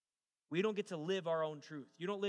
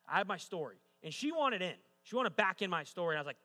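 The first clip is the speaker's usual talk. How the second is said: ordinary delivery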